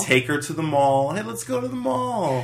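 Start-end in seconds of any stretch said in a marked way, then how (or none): 1.1-2.4 high-pitched, put-on eager squeal; hammed-up enthusiasm, voice swooping way up; mock excited invitation. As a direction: play an overexcited mallgoer. Quoting an eager mall invitation.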